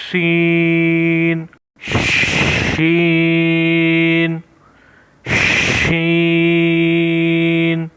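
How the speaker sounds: سین held very long with a whistling hiss. Shown as a demonstration. Teacher showing pupils the whistling letter, slow. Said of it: "سیٹی کی آواز نکالیں گے".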